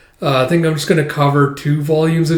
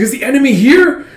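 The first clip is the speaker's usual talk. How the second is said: high, loud panicked question; alarmed cry; 12 semitones higher, 6 dB louder than usual. Retold or imitated. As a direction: shout it in confusion in the dark. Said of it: "It's like".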